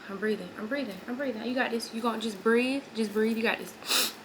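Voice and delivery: soft, repeated reassurance; acting out coaxing someone through breathing; hushed self-calming. Acting out being calmed to breathe.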